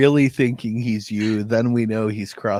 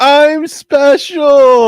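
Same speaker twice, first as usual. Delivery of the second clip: long, drawn-out high shout; mock boastful yell; 11 dB louder, much slower than usual. Teasing the featured guest about his status, mock boastful yell. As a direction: holler it slowly in mock self-importance.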